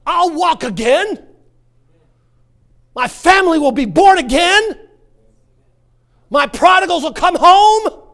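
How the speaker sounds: high, loud, slow proclaiming; bold declarations. Acted. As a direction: shout your faith out. Preacher proclaims faith declarations.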